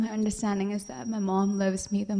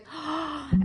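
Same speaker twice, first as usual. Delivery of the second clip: acted sharp gasp of discovery; shocked gasp; 6 semitones higher than usual. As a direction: gasp as if catching him out. Mother sees his face.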